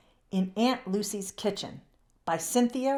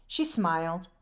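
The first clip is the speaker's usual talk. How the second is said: usual speaking voice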